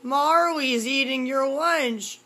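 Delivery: loud, slow, high exclamation; startled and loud; loud surprise. Real, not acted. Cat eats son's lunch.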